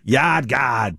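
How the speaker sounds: Yard Guard in a broad Rhode Island accent with dropped Rs. Shown as a demonstration. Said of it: "say it like a Rhode Islander".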